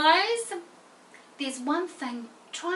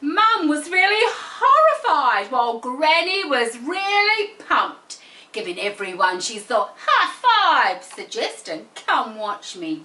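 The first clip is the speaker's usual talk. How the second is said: expressive; 6 dB louder than usual. Shown as a demonstration. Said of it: "Use expression".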